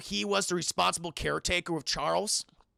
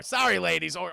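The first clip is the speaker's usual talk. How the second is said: usual speaking voice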